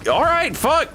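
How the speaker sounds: high, exaggerated startled surrender; mock taken aback. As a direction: back off in a comically high voice. Acts out taken-aback reply to insults.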